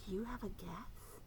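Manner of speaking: whispered guess question. Acted.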